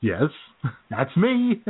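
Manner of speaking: slow, laughing through the words; laughing reply. Retold or imitated. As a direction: answer while laughing. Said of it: "And I said".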